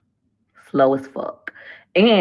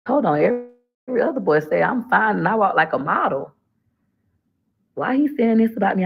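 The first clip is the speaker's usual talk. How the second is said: higher, half-offended double take; shocked disbelief; 5 semitones higher than usual. Retold or imitated. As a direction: play the girl who can't believe he said that. Retelling her own shocked reaction.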